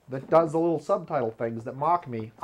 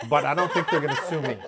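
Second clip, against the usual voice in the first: laughing; 4 dB louder, faster than usual. Real, not acted. Joking about not getting permission.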